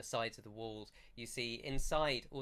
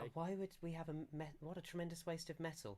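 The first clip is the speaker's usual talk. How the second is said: high, quick, softer villager voice; appalled villager; 6 semitones higher, 7 dB quieter, faster than usual. Acted. Villager hearing of a metal tub, appalled at the waste. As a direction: object in a light, hurried, scandalised voice.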